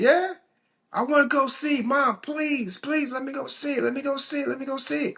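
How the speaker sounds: put-on high, softening kid's plea; begging child. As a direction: plead with mom, fading smaller. Kid begging mom for movie.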